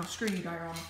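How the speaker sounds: ordinary talk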